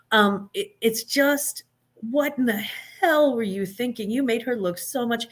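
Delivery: ordinary delivery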